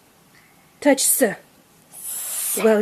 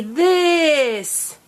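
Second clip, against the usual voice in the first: "this" drawn out long; said slowly; 4 semitones higher than usual. Shown as a demonstration. Teacher modelling a word for a child, said slowly. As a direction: stretch "this" out slowly. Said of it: "say the word slowly".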